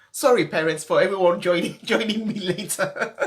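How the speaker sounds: laughing through tears; embarrassed laugh. Real, not acted. Apologising to viewers, laughing through tears. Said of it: "I didn't know I'd be this emotional".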